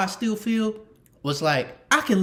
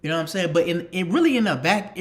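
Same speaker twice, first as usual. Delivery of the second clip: ordinary talk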